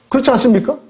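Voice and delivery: preacher's loud rising appeal to the pews; rising rhetorical call. Shown as a demonstration. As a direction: challenge the congregation to answer.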